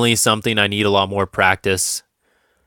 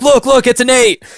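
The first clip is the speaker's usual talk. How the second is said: excited; 14 semitones higher, 5 dB louder than usual. Retold or imitated. Friend gleefully shows the winning roll.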